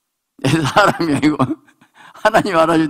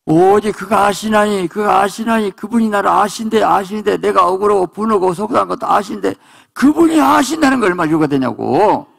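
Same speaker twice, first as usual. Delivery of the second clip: high, sustained, insistent preacher's cry; impassioned preaching; 8 semitones higher, 4 dB louder than usual. Real, not acted. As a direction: drive each phrase home on a raised pitch. Verse repeated in impassioned preaching. (높은 소리로 열렬하게 외치는 설교)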